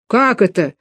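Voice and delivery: ordinary delivery, nothing marked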